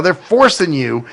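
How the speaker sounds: normal talking voice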